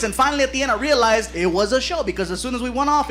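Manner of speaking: ordinary talk, nothing marked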